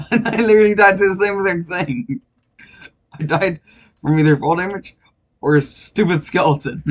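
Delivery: loud, exasperated gamer rant; frustrated loud complaining. Real, not acted. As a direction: vent loudly about dying. Complains about dying repeatedly.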